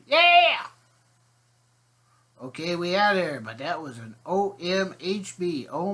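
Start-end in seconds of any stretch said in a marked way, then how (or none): 0.1-0.7 much higher and louder yelled yeah. Retold or imitated. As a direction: holler it like she can't hear herself. Hard-of-hearing grandmother answers.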